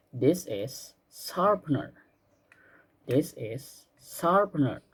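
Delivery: slow and clear, word by word; slow model sentence. Shown as a demonstration. Teacher modeling a sentence for pupils to repeat, slow and clear. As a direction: spell it out slowly for the class to copy.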